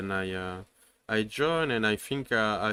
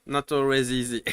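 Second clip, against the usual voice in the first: ordinary talk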